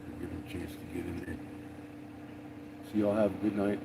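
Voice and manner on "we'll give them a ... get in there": fast, softer whisper; whispered aside. Real, not acted. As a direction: slip it in under your breath. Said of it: "soft aside in sign-off".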